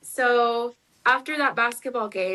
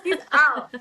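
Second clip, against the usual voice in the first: higher, louder, laughing he's out; mock despair; 4 semitones higher, 6 dB louder than usual. To a friend, repeating her panic with laughing despair. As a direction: repeat it as a comic, hopeless verdict.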